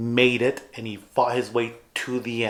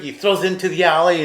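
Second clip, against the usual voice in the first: ordinary talk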